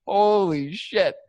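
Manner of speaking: drawn-out shock. Retold or imitated. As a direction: let it hang in disbelief. Shocked at what vultures eat.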